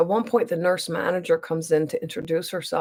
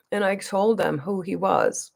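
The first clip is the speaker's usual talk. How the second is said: ordinary talk, nothing marked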